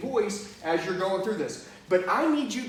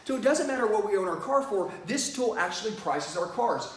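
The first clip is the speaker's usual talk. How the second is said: ordinary talk, nothing marked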